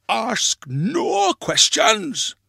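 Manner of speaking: high, very slow, drawn-out command; shouted order. A narrator's reading. Grandpa to prying grandchild, shouted. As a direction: shut the questioner up, stretching each word. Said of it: "and shouted".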